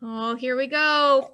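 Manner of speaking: ordinary delivery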